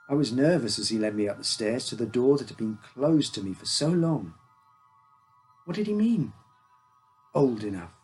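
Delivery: usual speaking voice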